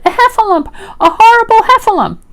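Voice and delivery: high, loud panicked cry; squealing alarm; Piglet. A narrator's reading. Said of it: "cried Piglet".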